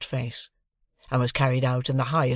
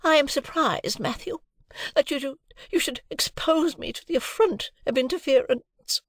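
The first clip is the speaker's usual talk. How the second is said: much higher, affronted lady voice; a proud wife refusing all interference; high indignant lady voice; haughty Mrs Pocket; 13 semitones higher than usual. A narrator's reading. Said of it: "said Missus Pocket".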